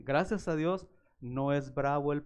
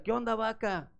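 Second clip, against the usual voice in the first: mocking taunt; 7 semitones higher than usual. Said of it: "siempre le decía".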